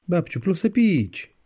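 put-on high, drawn-out small child's plea; little grandson. Grandson asks busy grandma for a drink, high child voice. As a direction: voice a small child tugging for attention, high and slow.

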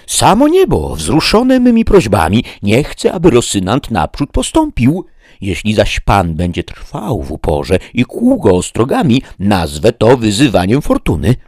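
high, loud triumphant cry; Sancho gleeful. Acted. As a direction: crow it out, pleased with your trick. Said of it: "zawołał Sancho, mocno rad".

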